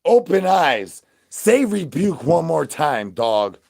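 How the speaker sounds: loud, slow, high call. Real, not acted. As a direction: boom it out slowly. Calling out to viewers.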